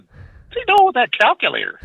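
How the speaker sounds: high-pitched, puzzled retold question; baffled question. Telling Bob about the boy with the calculator, baffled high voice. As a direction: shoot the pitch up in mock disbelief. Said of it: "I said".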